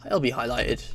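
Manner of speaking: ordinary delivery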